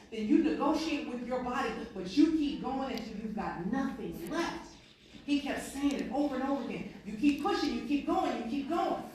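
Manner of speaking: loud, high, urgent shouting; yelling coach. Retold or imitated. Coach yells at crawling player, loud and urgent. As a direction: drive him on at full volume.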